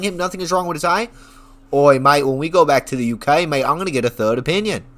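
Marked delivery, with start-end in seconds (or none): 1.7-4.8 imitated British accent drifting into Australian; loud "oi" opening, mock British-Australian accent; botched British accent. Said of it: "More of an Australian accent".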